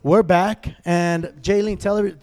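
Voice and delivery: loud, upbeat show opener; show host intro. Acted. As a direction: hype up the show intro, drawing out names. Host restarting the episode.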